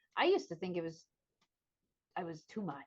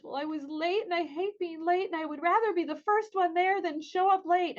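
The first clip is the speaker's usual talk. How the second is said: put-on higher, louder child's complaint; upset daughter; 9 semitones higher, 7 dB louder than usual. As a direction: voice the twelve-year-old protesting being late. Daughter explains why she was upset.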